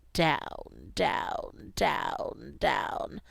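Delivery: slow descending low voice; sinking voice. Pretend. Temperature dropping, voice sinks down.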